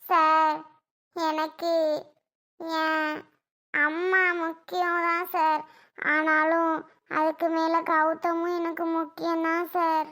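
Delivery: faltering plea to the officer; tearful hesitant answer. Acted. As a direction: hold back tears between each word. Daughter torn, answering police.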